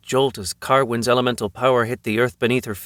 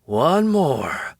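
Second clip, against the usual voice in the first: effortful grunt, a bit higher; 4 semitones higher than usual. A narrator's reading. Man making the ground roll, grunted. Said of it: "he grunted".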